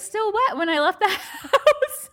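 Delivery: giggling through the words; words broken up by real laughter; laughing. Real, not acted. Laughing while telling the story.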